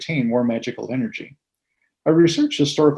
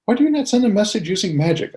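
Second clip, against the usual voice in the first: higher-pitched, lightly voiced young apprentice; a touch higher, as a pupil asking; questioning apprentice; 8 semitones higher than usual. Young apprentice questions his mistress.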